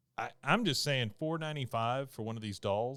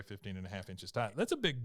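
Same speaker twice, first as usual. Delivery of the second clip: normal talking voice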